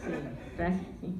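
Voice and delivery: put-on low, soft old Spanish grandma voice; grandma impression. Comedian imitating her grandma cooing at her in Spanish, low and soft. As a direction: croon at the granddaughter like an old abuela. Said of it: "She's like".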